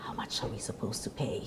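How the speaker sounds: a soft unvoiced whisper, off to the side; hushed aside to the guest; whispered question. Real, not acted. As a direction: slip the question in under your breath. Host slips in whispered question.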